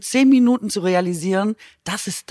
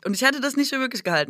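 ordinary talk

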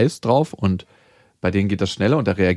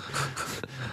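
leises gehauchtes Kichern; 3 semitones higher, 16 dB quieter than usual. Real, not acted. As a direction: kurz und leise kichern. Lacht nach eigenem Satz. (leises Kichern)

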